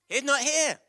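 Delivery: loud, high proclamation. Shown as a demonstration. Leader proclaiming the Easter line to the congregation, loudly. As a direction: announce it to the whole room.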